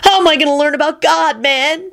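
high mock-pitiful whine; piteous wail. A narrator's reading. Diner pleads to be taught, piteously wailing. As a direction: wail it high like a hard-done-by dude. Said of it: "Kenny wailed piteously".

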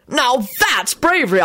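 high, slow, emphatic admiration; excited shout. A narrator's reading. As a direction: declare it with great admiration. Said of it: "Arthur shouted".

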